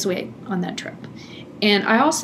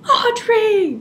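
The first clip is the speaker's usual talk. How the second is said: high, rising yell of her name, twice; mock yell; 13 semitones higher, 3 dB louder, much slower than usual. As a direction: call her out in exasperated alarm. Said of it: "yelling at her".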